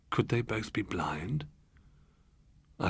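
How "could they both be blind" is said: a frightened whisper to yourself; whispered dread; hushed whisper. A narrator's reading. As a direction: breathe it out, slow and voiceless. Narrator's dread at silent window.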